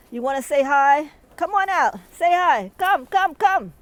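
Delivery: high, loud sing-song call to come out; coaxing call. Real, not acted. Calling outside for a buddy to come out, high coaxing call. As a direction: coax them out warmly and loudly.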